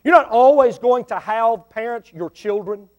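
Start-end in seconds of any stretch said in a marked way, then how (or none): none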